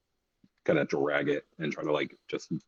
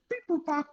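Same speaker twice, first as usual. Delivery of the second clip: high squeaky beep boop bop; robotic beeping; 4 dB quieter than usual. Pretend. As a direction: chirp it like a little machine. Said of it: "I could be like".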